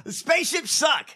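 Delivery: mocking outburst. Real, not acted. Mocking SpaceX with laughter.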